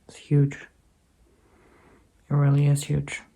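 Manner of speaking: soft, slow, hushed appeal; solemn prayer request. Real, not acted. Speaker asks viewers to pray for a friend's boyfriend, solemn. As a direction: ask gently and quietly, with slow pauses.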